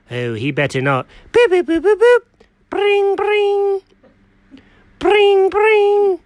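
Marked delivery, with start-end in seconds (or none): 1.3-2.2 high mouth beeps of keys pressed; phone dial beeps. Acted. Cat dialing Alfred, high keypad boops. 2.7-6.2 high sung ring tone, falling at the end; phone ringing. Acted. Cat waiting for Alfred, high mock ringtone.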